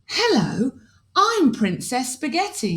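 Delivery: lightly voiced polite princess; a hint of a polite little princess. Princess greeting the aliens, lightly voiced and polite. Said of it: "with a polite curtsy".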